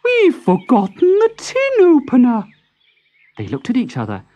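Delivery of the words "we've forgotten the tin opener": a much higher, dismayed mother tortoise voice. A narrator's reading. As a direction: gasp it out as the mother who just noticed. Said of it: "And at last she said".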